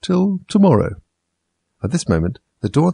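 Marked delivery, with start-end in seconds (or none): 0.0-1.0 slow high footman voice. Acted. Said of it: "the footman remarked".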